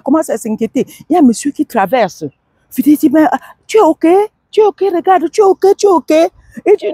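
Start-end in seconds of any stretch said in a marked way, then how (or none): 3.1-6.1 high, urgent stranger asking if he's ok; a pitched-up, worried check on the fallen man; passer-by voiced high and anxious; concerned passer-by.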